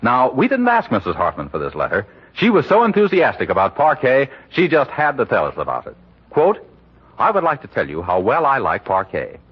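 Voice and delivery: ordinary delivery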